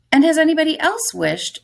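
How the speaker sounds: put-on high, loud old lady's question; lightly voiced old woman asking the soldier. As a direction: pitch it up as the eager old woman. Teacher voices the old lady.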